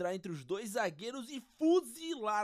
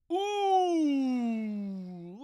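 jeering boo; 2 semitones higher, 6 dB louder than usual. Acted. Crowd-style jeer.

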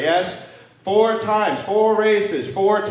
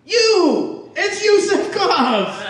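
mock hype announcer; 5 dB louder, much slower than usual. Mock announcing his own name.

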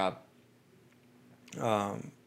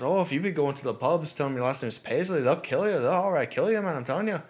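higher, lilting imitated Irish voice; warning Irish cabbie; 5 semitones higher than usual. Irish cabbie warning a tourist off the pubs, insistent. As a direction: insist on the danger in a mock Irish brogue.

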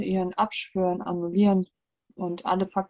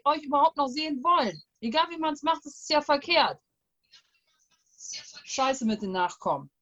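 put-on grumpy old man; 3 semitones higher than usual. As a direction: complain like an offended old man. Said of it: "Ich mach mal den ganzen Opa".

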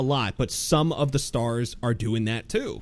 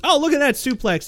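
high, hyped play-by-play call; mock excited commentator; 13 semitones higher than usual. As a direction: oversell the move like a commentator. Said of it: "call the same move a suplex".

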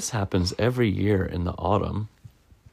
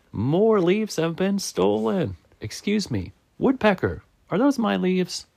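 indignant Squirrel; 10 semitones higher than usual. Acted. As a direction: accuse in a high, upset voice.

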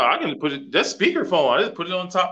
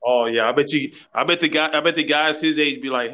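ordinary talk

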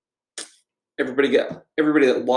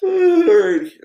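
yawning; 7 dB louder than usual. Real, not acted. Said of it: "Oh, I'm yawning".

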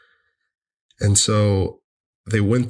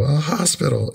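higher-pitched delighted echo; retold amazement; 7 semitones higher than usual. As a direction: echo the thrilled disbelief, pitched up. Echoing mom's amazement back.

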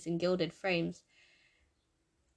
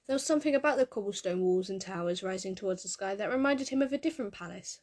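ordinary delivery

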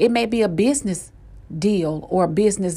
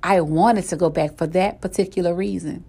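ordinary talk

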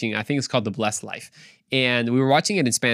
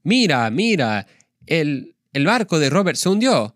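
Spanish dub; 3 semitones higher, 4 dB louder than usual. Retold or imitated. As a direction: imitate an overdone Spanish voice-over. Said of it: "it went kind of like this".

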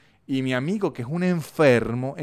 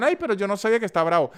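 mock whiny excuse; 7 semitones higher, 4 dB louder, much faster than usual. Mocking the offended recipient.

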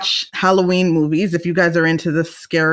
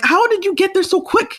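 much higher, incredulous question; mock disbelief; 12 semitones higher than usual. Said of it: "then you're like".

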